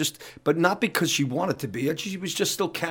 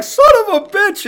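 imitating dad's high, loud screamed curse; furious shout; 11 dB louder than usual. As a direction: yell it like an angry dad whose kid just farted in the car. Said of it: "he'd be like".

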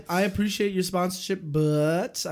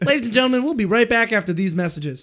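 higher, louder broadcast voice; mock announcer; 5 semitones higher, 5 dB louder, faster than usual. Host cuts to a break as a TV announcer.

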